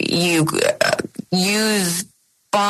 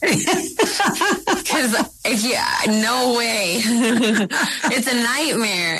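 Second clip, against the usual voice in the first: real laughter breaking into the words, higher pitch; laughing; 6 semitones higher, much slower than usual. Real, not acted. Actress answering whether she'd try the substance, laughing. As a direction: laugh through a disbelieving refusal.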